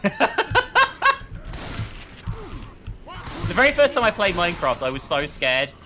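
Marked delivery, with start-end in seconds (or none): 0.4-3.4 high, wheezy voiceless laugh; helpless laughter after a scare; laughing fit. Real, not acted. Player laughing after screaming at the game, wheezy laugh.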